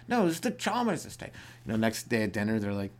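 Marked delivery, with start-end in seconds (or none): 0.1-1.3 high-pitched mock indignation; hammed-up huffy correction; exasperated mansion owner. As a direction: protest to an intercom prankster, voice pitched up. Acted exasperated mansion owner on intercom.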